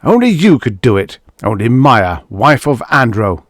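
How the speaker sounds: loud bitter accusation; husband accusing his wife; furious. Acted. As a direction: spit the accusation at her. Husband's fury continues.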